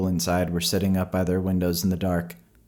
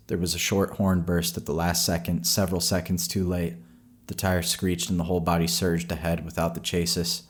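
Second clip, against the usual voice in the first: usual speaking voice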